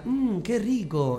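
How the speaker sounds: slow, savoring ad line; drawn-out yummy commercial read; mock TV ad tasting voice. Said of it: "propaganda de Nor que decía".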